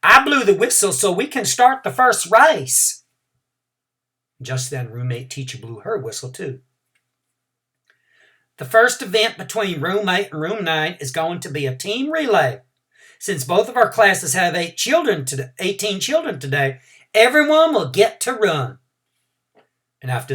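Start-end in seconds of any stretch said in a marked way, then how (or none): 0.0-3.0 put-on high, loud teacher call over the kids. Teacher calling the excited class to settle, loud and high. 8.6-18.8 higher, louder teacher voice; teacher announcing. Acted. Teacher explaining the relay to two classes, raised voice.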